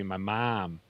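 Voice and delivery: "mom" with an open, drawn "ah" vowel; Southern US "mom". Shown as a demonstration. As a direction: say "mom" as "mahm", American style. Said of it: "down here it'd be mom".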